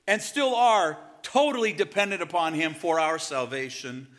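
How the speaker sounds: loud, high preaching at full cry; full-force preaching. Acted. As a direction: preach it out to the whole church.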